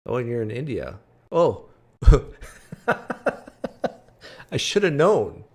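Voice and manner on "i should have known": ordinary delivery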